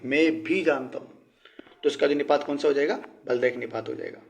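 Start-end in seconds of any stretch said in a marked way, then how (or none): 0.1-1.3 slow, weight on भी in मैं भी जानता हूँ; stress on भी. Shown as a demonstration. Teacher repeating the example for students, emphatic. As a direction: press the word भी. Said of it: "विशेष रूप से इसके ऊपर जोर दिया जा रहा है".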